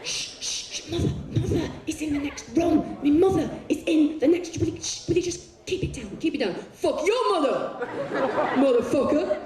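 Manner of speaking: high, hushed nagging shushes; urgent high-pitched pleading to keep it down; hushing lover. Acted. Lover hushing partner with mother next door, urgent.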